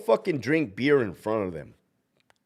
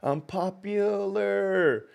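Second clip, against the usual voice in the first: drawn-out joke; 8 semitones higher, much slower than usual. Real, not acted. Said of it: "joking boast".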